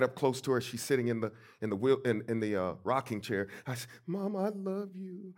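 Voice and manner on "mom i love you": put-on high, soft, slow little-boy voice; tiny child clinging to Mama; five-year-old. Five-year-old to Mama before the thundering voice plays, small and pleading. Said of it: "I'd be about five years old".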